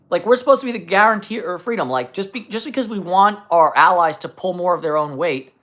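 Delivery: ordinary talk, nothing marked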